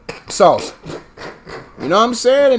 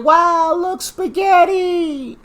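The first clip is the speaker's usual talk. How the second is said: mock excitement; 8 semitones higher, much slower than usual. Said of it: "I'm over here just like".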